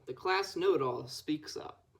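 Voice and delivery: ordinary delivery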